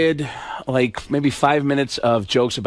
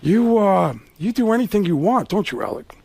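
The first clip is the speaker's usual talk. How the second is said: Al Pacino impression. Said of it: "Pacino's looking, going".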